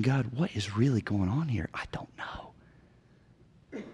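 whispered prayer of helplessness. Retold or imitated. Pastor voicing his helpless prayer, whispered. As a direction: whisper it to God, lost. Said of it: "And I'm saying".

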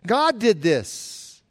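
each word set down slowly; slow and clear. Shown as a demonstration. Preacher stating plainly to the congregation, slow and clear. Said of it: "let me say it clearly".

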